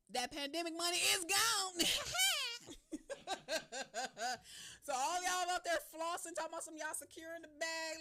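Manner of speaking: loud, high, laughing jab; mock the big spenders through laughter; laughing tease. Real, not acted. Host teasing listeners whose money is gone, laughing.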